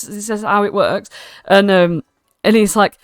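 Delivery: ordinary talk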